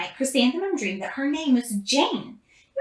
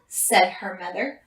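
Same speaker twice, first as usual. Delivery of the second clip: usual speaking voice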